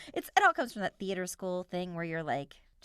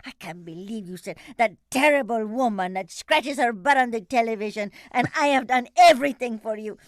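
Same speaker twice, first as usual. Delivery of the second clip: loud indignant scolding, mock outrage; imitating angry grandma; 8 dB louder than usual. Grandma scolding her granddaughter after the pageant, mock furious. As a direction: play the furious grandmother, voice rising. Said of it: "she's like".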